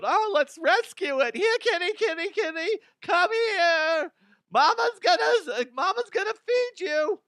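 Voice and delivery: very high sing-song coaxing of a stray cat; high-pitched mama-cat baby talk; coaxing kitty voice. Retold or imitated. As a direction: call the tiger like a pet kitty. People coaxing tiger like kitty.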